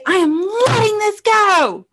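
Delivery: high, drawn-out cathartic scream; strained high-pitched yell; screamed declaration. Shown as a demonstration. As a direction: scream it out slowly with full force. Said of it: "And you're gonna scream".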